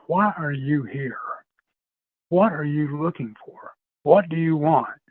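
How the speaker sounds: slow, deliberate questioning, lightly menacing; intimidating. Retold or imitated. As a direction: retell it as a slow attempt to intimidate. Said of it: "kind of trying to be intimidating".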